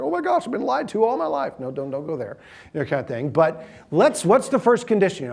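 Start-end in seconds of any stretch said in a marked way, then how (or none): 0.0-1.5 mock dismay. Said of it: "So you're like".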